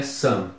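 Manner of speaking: SOME said as "sum"; pronunciation demo. Shown as a demonstration. Teacher showing how S O M E sounds, pronunciation demo. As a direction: model "some" with a short uh and closed m. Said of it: "E se pronuncia".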